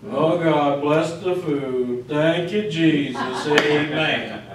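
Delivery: low, slow, droning mock prayer; imitated gloomy mumbling of grace, pitch sunk down; doom and gloom. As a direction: intone grace as if utterly miserable. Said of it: "they're not all doom and gloom".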